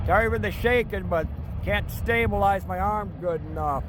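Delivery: speaking up over the rumble of a train. Real, not acted. Filming a loud passing train.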